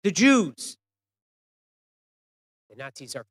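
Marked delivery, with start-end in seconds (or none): 0.0-0.8 very loud, high defiant shout; storyteller's full-force cry; retold scream. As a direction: shout it out from the crowd. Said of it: "a scream".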